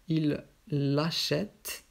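"il l'achète" very slow, lingering on the L of "l'achète"; emphasis on elision. Shown as a demonstration. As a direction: hold the double L in "il l'achète". Teacher models elided pronoun. (appuyer lentement sur le « l' » de « l'achète »)

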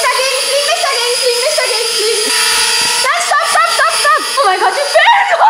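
high frantic stop; panicked shouting. Real, not acted. Drone out of control.

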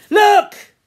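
high, very loud demand; sharp shout. Acted. Jack showing his wounded arm, loud demand. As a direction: shout it to grab their attention.

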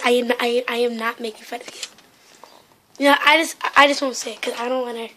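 normal talking voice

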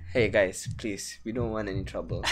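low, soft feigned innocence; pretend peacemaker. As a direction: plead gently as if avoiding trouble. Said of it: "we pretend".

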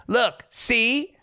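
high, smug little jab; mock gloating housewife. Reality star to DJ, high smug mimicry. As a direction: point it out like she's been proven right.

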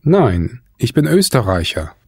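normal talking voice

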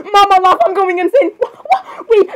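high, wailing mock freak-out; hysterical disbelief. Princess shocked by horse-man sign.

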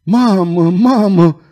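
high, loud sobbed cry of mamă; sobbing. A narrator's reading. Violeta sobbing over her dying mother. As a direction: sob it out in anguish. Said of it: "hohoti Violeta".

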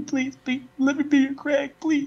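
very high laughing beg; pleading squeal. Pretend.

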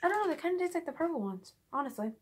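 speaking between bites; talking while chewing. Real, not acted. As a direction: mouth partly full of food. Seen 2: Lumpy cheeks, shifting jaw and pressed lips between words while tasting.

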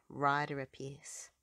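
ordinary delivery

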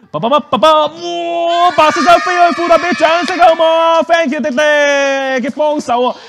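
high, loud, triumphant showman call; showman's big reveal. Acted. Magician to children as the scarf lands, triumphant. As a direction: stretch it out high for the crowd's applause.

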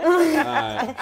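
high laughing "all right". Real, not acted. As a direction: laugh through the words. Laughing after teasing.

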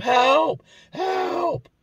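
very high, drawn-out desperate cry; imitated scream for help. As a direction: scream out as the victim, acted. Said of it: "He starts screaming for help".

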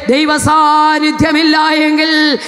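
high, sung-out shouting preacher; preaching at full force. Real, not acted. Preacher sings out the warning to the congregation, high and shouting. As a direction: lift the whole church with a singing cry.

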